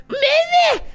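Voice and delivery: very high squealing creature cry; ghast impression. Player showing friend how ghasts sound, shrieking creature cry. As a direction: screech like a whining little monster. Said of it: "that's what gas sound like".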